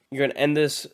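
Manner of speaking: ordinary talk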